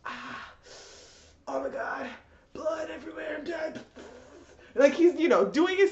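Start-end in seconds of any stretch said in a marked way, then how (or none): 0.0-3.8 mock dying whisper. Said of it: "he's still like".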